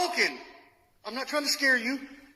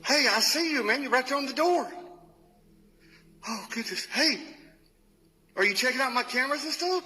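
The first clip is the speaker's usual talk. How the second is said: high, keyed-up calls to an unseen spirit; thrilled and nervous, calling into the dark; excited calling out; 6 semitones higher than usual. Real, not acted. Ghost hunter calls out to a spirit in front of him, excited.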